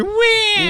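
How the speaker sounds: high fake crying wail; mock crybaby wah; mock baby wail. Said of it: "it's just like".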